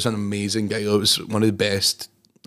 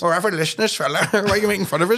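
higher, put-on voice of an offended Scottish band member; whiny band impression; 8 semitones higher than usual. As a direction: play the hurt band member, pitched up. Mimicking the band complaining.

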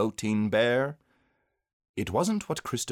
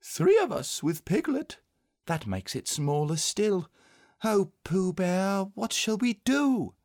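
put-on high, plaintive child's voice; worried little boy, high; dismayed young boy; 12 semitones higher than usual. A narrator's reading. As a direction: appeal to a friend in dismay, high-pitched.

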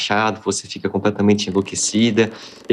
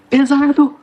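high, loud yell from far off; shouting from above; 4 dB louder, slower than usual. Acted. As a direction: call down from up high, straining. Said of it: "o Ori gritando lá de cima".

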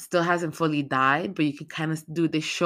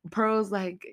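ordinary delivery

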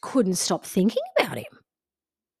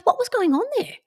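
higher, prying friend voice; curious friend; 12 semitones higher, faster than usual. Retold or imitated. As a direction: ask it up high like a nosy friend. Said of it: "she goes".

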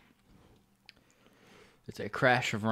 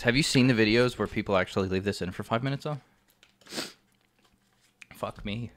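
ordinary talk, nothing marked

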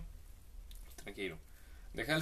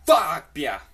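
loud, higher cursing shout; hammed-up shouted swear; mock yell; 5 semitones higher, 15 dB louder than usual. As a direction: let the curse rip outside. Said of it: "y grita".

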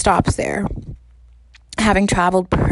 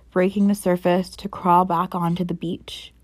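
usual speaking voice

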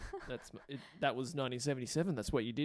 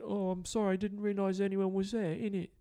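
put-on light London punk accent, a bit higher; a hint of a London accent from a polite punk; 7 semitones higher, 4 dB louder than usual. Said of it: "I feel like I can do an accent".

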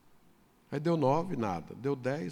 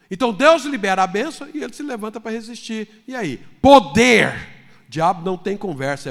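loud, high, fervent sermon delivery; shouted preaching with emphatic punches; full-force preaching; 7 semitones higher, 10 dB louder than usual. Real, not acted. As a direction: preach it with full fervor to the congregation.

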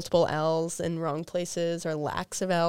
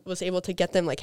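usual speaking voice

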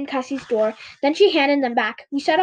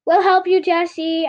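high, loud call; crying out; 5 semitones higher, 4 dB louder than usual. A narrator's reading. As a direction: call out to come to the rescue. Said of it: "I heard my sister cry".

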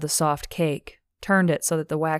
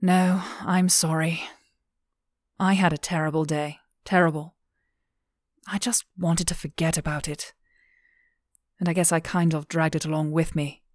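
weary apology. Acted. Friend apologizes after a terrible day.

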